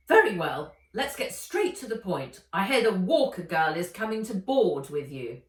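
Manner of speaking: loud, commanding villainess; lightly voiced overbearing old woman; Ivy, domineering. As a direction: push straight to the point, bossy. Ivy making demands.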